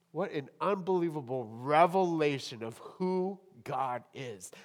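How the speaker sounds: ordinary delivery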